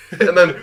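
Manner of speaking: imitating Eddie Murphy's eighties cackle; hee-hee laugh, a hint of Eddie Murphy. Friend imitating Eddie Murphy's laugh, light cackle. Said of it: "the second gear of his laugh".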